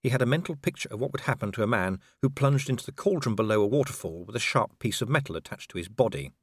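plain reading voice

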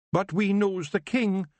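high Scots wee-man voice, cheerful; a tiny Scottish warrior boasting; Feegle chieftain, proud. Acted. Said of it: "said Rob".

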